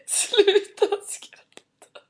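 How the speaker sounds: very high, slow, squeezed out through laughter; high giggly wail, barely getting it out; helpless giggling. Real, not acted. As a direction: plead through a laughing fit. Helpless giggles, begging co-host to stop.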